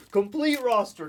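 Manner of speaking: loud, high imitation outburst; hammed-up yelled imitation; mock shout. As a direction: call it out high and loud in jest. Repeats mock rant between laughs.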